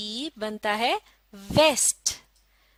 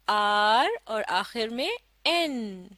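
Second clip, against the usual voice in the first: slow spelling out; slower than usual. Shown as a demonstration. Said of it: "आप भी मेरा साथ दीजिए".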